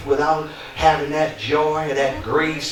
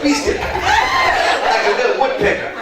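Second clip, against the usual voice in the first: mock mother scolding; slower than usual. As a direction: squeal it like mama yanking the comb. Mimicking mother scolding sister.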